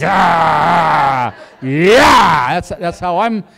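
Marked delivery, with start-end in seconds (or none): 0.0-2.5 loud drawn-out laser shout; laser-eye blast. Acted. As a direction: fire lasers with your voice. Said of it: "I've got, like, laser eyes".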